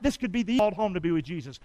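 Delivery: ordinary talk, nothing marked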